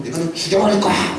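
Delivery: a hint of a vicious snarled threat; cursing threat. Jezebel cursing Elijah with a mild snarled threat. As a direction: spit the threat like a furious queen. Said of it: "막 욕하면서 막".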